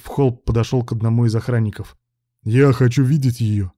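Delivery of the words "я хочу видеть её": slow, emphatic command; barked demand. A narrator's reading. Ruslan demands of the guard, barking. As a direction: bark it at the guard, measured and firm. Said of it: "рявкнул он".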